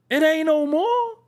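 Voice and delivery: a sharp, high comeback; high retort. Real, not acted. Comedian answering the organic label with a high retort. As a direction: jump up high to shut it down.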